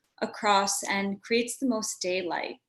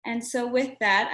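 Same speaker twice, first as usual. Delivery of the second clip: ordinary delivery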